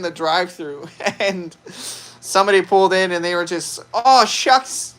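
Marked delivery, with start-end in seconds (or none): 3.9-4.9 mock Minnesotan, pitched up; Minnesota accent. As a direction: imitate a folksy Minnesota accent. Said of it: "they were just so Minnesota".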